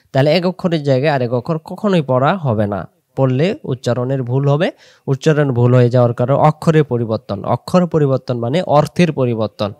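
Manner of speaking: ordinary delivery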